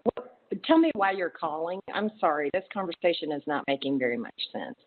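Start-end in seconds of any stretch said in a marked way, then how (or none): none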